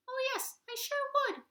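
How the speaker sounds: very high squeaky polite voice; tiny toad voice. Acted. Tree Toad interrupting the animals, very high squeaky voice.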